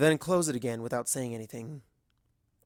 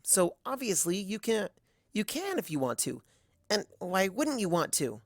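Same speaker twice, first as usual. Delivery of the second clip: put-on higher, urgent young voice; desperate filly; 6 semitones higher than usual. Other Twilight demanding help from Twilight, high and urgent. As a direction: push back in disbelief, voice climbing.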